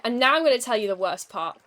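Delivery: ordinary delivery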